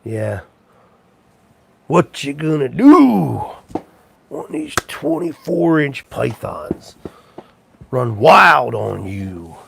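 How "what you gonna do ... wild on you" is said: Hulk Hogan catchphrase. Retold or imitated.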